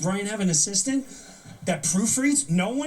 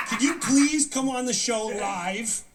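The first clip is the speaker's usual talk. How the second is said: ordinary delivery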